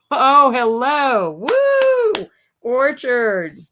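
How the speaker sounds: high, loud woo after a slow hello; excited whoop. Real, not acted. Host spotting the hidden word, whooping high. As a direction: cheer as the answer jumps out.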